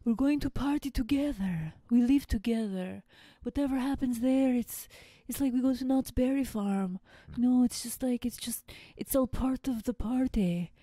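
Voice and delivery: lightly done Czech accent impression. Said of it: "in his little Czech accent".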